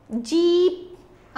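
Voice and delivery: जीप with the ई held long; long vowel. Shown as a demonstration. Teacher showing children the long sound, जीप drawn out. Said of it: "ई का long sound आ रहा है".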